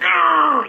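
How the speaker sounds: loud, high mock "arr"; imitated angry growl-yell. Pissed-off man repeating himself, loud high mock roar. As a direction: burst out in a wordless caricature of rage. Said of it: "He's all pissed off".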